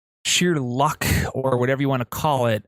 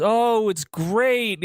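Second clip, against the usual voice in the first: high, slow, sing-song reassurance; chirpy fake calm over the phone; fake cheerful lie; 9 semitones higher, much slower than usual. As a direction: sound breezy to hide the danger. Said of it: "he tells her this lie".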